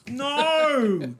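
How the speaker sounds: high, slow, pleading don't. Real, not acted. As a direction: beg them not to, high and drawn out. Pleading against booking.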